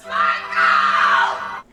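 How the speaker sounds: low, loud drawn-out roar; imitated yell. Heroine with fire axe calling the killer out, imitated yell. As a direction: bellow the name long and deep. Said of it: "and she yells".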